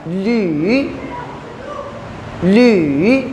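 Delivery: ली dipping low then rising, Mandarin third tone; teacher demo of ली in third tone. Shown as a demonstration. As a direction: sound out ली with a dip and rise. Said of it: "third tone के साथ पढ़ रहा हूँ ना".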